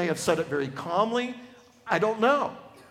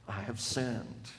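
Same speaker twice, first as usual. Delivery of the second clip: hushed confession; 7 semitones lower, 10 dB quieter, slower than usual. Acted. David confesses, very soft.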